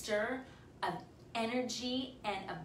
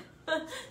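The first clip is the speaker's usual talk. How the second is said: a quick, high embarrassed giggle; embarrassed laugh. Real, not acted. Host on a live stream laughing off an awkward joke, high giggle. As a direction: laugh it off after the awkward remark. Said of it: "Sorry, I'm saying that on the live".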